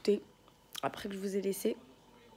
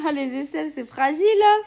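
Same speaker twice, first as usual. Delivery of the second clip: playful teasing; 6 semitones higher, 16 dB louder than usual. Real, not acted. Teasing while tickling.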